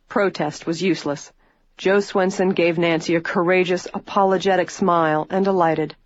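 ordinary delivery

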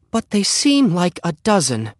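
high-pitched motherly complaint; exasperated mother. A narrator's reading. Flustered mother complaining of kittens.

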